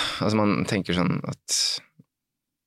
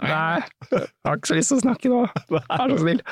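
pleading; 12 semitones higher than usual. Retold or imitated. Silently begging chatty runner to stop.

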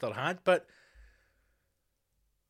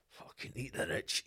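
whispered; 9 dB quieter than usual. Acted. Muttered slogan.

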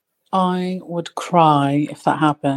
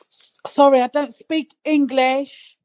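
slowed-down fake broken English, pitched up; feigned foreigner; 8 semitones higher, slower than usual. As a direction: pretend not to understand him. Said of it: "And I said".

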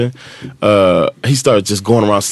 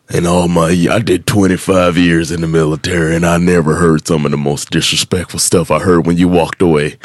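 stern drill sergeant; 5 semitones lower than usual. Retold or imitated. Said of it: "He's like".

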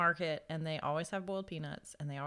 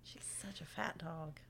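whispered aside; 3 semitones lower, 12 dB quieter than usual. Real, not acted. She whispers a tease about her fat dog, whispering. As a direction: hush it as a sly aside.

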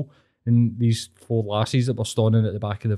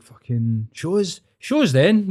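badly imitated Inverness lilt rising high at the end; mock an Inverness accent, jumping up in pitch; hammed-up Inverness accent; terrible Inverness accent; 5 semitones higher, slower than usual. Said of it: "That was a terrible Inverness accent".

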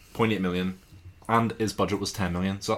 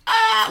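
mock scream; 9 dB louder than usual. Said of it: "she's screaming at him".

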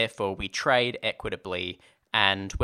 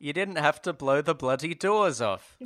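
Michael Caine impression; 5 semitones higher than usual. Co-host shows off his Michael Caine voice. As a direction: do your best Michael Caine. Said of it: "That's my Michael Caine".

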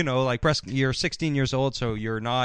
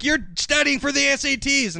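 mock accusation; 12 semitones higher than usual. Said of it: "she's like".

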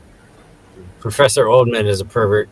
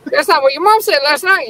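very high squawky parrot; mock parrot voice. The parrot boasting to Cindy, very high squawk. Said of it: "And then he's like".

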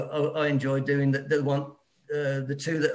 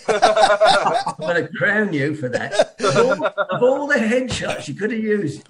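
laughing; 6 semitones higher, 7 dB louder, slower than usual. Real, not acted. Jokey threat over pink hat.

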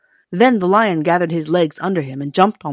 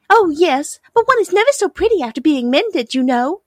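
high little princess voice, louder; dainty china princess; 11 semitones higher, 5 dB louder than usual. A narrator's reading. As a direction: explain primly as a fragile china lady.

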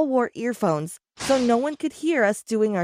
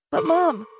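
higher, startled "But Mom"; surprised; 6 semitones higher than usual. A narrator's reading. Kid to mom as she walks out, high surprised protest. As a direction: caught off guard, pitch jumps up. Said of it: "I said in surprise".

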